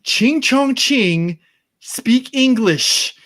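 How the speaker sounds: loud, high, drawn-out jeer. Pretend. Mimicking a bigot heckling Chinese speakers, slow mocking shout. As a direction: stretch each word in a loud mock rant.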